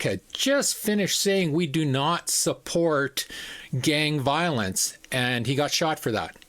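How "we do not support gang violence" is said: each word spaced out slowly; slow deliberate quote. Retold or imitated. Quoting Kirk's last words.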